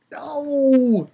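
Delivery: high, thin wailing no; dismayed wail. Retold or imitated. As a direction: wail a long, despairing no, not shouted. Reenacts reaction to cliffhanger ending.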